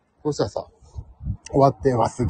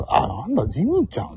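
mock surprised recognition; 7 semitones higher than usual. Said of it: "みたいに".

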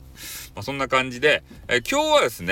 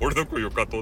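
lightly put-on goofy voice; mock-suave question gone silly; 3 semitones lower than usual. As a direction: say it in a daft voice, half laughing. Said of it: "バカみたいな声になっちゃった".

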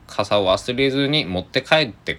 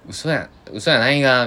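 Tosa dialect; 7 semitones higher, 6 dB louder than usual. Retold or imitated. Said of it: "土佐弁では使いました".

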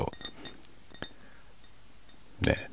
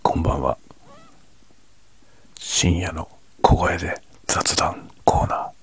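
quiet at night. Real, not acted. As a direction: whisper the show title softly.

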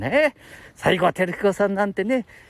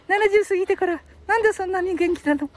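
high-pitched mock complaint; wife's gripe in a high puppet voice; complaining puppet; 12 semitones higher than usual. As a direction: grumble as a little high dummy.

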